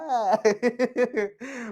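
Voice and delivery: a short real laugh. Real, not acted. Teacher laughing at his own mimicry. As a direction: laugh at the joke.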